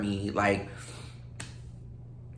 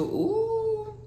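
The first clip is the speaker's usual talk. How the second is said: sudden high ooh as her foot tingles; ooh of discomfort. Real, not acted. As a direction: yelp at a sleeping foot. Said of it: "my foot fell asleep".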